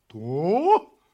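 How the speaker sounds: loud, drawn-out rising "what"; loud disbelief, sliding up in pitch; astonished. A narrator's reading. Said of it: "удивилась собака". (громкое удивление)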